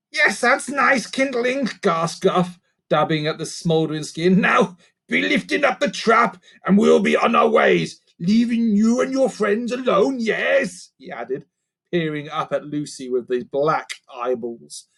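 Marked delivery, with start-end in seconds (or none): 0.1-1.7 slow, higher croaky goblin; creaker voice. Acted. Gruff creature voice. 4.4-10.9 higher goblin voice, bargaining; a sly creature striking a deal; creaker voice. Acted. Gruff creature voice.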